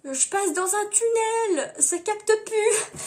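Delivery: high, drawn-out excuse; high, slow fake bad-connection act; Jimin. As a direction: stall in a high put-on voice. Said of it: "je lui demande sa réponse, il fait".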